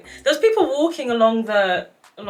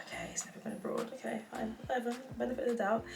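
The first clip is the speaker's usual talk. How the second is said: hushed, fast unvoiced aside to herself; whispered inner thought; 16 dB quieter, faster than usual. Whispering to herself about the date. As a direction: mutter it under the breath, resigned.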